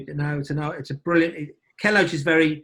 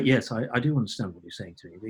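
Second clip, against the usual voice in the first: neutral voice; 5 semitones lower, 5 dB quieter than usual. Retold or imitated. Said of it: "you become normal. You go".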